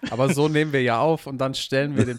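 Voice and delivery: laughing loudly through the line. Real, not acted. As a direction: say it while laughing hard. Laughing at the joke.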